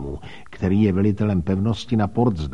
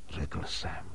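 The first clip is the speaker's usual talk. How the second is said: ordinary delivery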